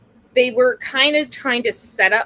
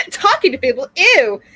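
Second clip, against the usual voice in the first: laughing, mock disgust; 4 semitones higher, 8 dB louder than usual. Real, not acted. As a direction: giggle out a disgusted ew. Mocking own introversion.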